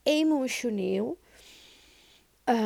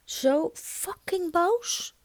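angry; 9 semitones higher, much slower than usual. Real, not acted. Opening rant, topping previous line.

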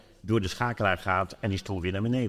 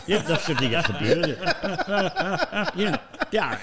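talks through real laughter, voice pitched up; laughing; 10 semitones higher, 4 dB louder than usual. Real, not acted. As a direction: laugh the words out. Lacht om de biefstukanekdote. (lachend pratend)

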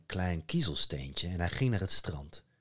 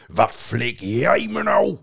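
loud, slow enraged troll; furious troll; 6 semitones higher, 11 dB louder, slower than usual. A narrator's reading. Troll furious at Loki, loud and slow. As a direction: roar it out in rage. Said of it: "de troll werd woest".

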